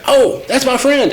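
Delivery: high, slow, delighted puppy voice; happy dog's voice. Retold or imitated. Owner voicing her puppy recognizing a friend, high and slow. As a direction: give the dog a sweet squeaky thought. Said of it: "he's like".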